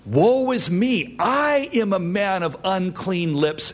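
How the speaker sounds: high, anguished prophetic cry; Isaiah. Acted. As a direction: cry out in woe, pitched well up. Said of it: "as Isaiah cried".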